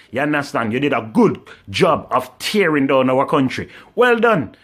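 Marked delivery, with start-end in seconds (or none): 4.0-4.5 higher, louder mocking well done; sarcastic praise. Real, not acted. Ranting at politicians, sneering sarcastic well done. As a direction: throw the praise back scornfully. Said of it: "you know say sarcasm".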